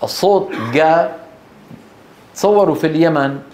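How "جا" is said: جا pronounced ga, like Yemeni qaf; a hard back g in جا, no j. Shown as a demonstration. Teacher demonstrates a regional sound, hard g. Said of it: "بدل القاف قاف".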